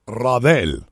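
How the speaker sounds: Radel with rolled Spanish r, rah-DEL; Spanish pronunciation. Shown as a demonstration. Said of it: "That has kind of a nice ring to it".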